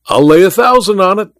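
high, rising challenge; excited cry. A narrator's reading. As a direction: cry out the wager. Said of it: "cried Welton".

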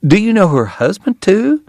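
high, eager question; excited hostess, pitch climbing at the end; thrilled Southern lady. A narrator's reading. Thrilled wife presses her guest.

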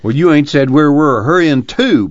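loud, high, sneering jab; a sarcastic son needling his mother. Acted. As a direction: throw it back at her with scorn. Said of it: "Paul reminded her sarcastically".

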